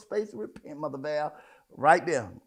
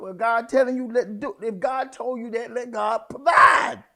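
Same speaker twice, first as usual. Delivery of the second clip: parents shouting back; 3 semitones higher, 7 dB louder than usual. Retold or imitated. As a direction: hammer home let God provide. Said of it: "they said".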